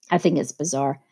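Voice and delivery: usual speaking voice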